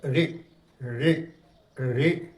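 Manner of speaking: रे repeated as separate short syllables; short syllable drill. Shown as a demonstration. Teacher drills the pronunciation, one short रे at a time. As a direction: repeat रे evenly, a pause after each. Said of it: "इस तरह से इसका उच्चारण".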